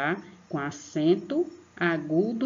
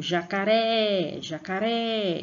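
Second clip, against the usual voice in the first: "jacaré" called out, "ré" high and stretched; stress on last syllable; 3 semitones higher, slower than usual. Shown as a demonstration. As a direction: lean hard on the "ré" of "jacaré". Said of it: "percebam que no ré".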